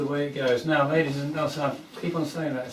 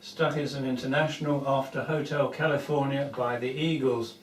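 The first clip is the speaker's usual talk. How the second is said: ordinary talk, nothing marked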